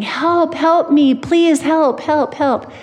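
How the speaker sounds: high, pleading mock yell; retold yelling. Stranded driver yelling after hearing nothing back, mock yell. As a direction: act out repeated calls for help. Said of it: "I'm just yelling and yelling".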